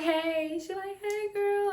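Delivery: put-on high, drawn-out girlfriend hello; chirpy greeting. Acts girls' phone greeting.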